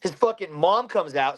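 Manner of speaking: ordinary delivery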